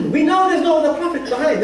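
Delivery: lightly acted royal surprise; retold surprise. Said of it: "We're surprised".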